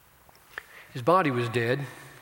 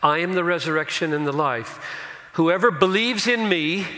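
preaching at full force; 6 semitones higher, 6 dB louder than usual. Acted. Preacher proclaiming Jesus' words to the congregation, loud. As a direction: thunder the promise out to the church.

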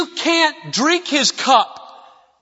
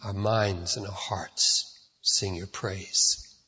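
prayer; 12 semitones lower, 6 dB quieter than usual. Acted. Pastor praying softly to God for the congregation, low and hushed. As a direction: pray quietly to God before preaching.

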